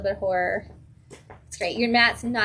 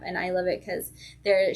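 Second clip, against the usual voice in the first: ordinary talk, nothing marked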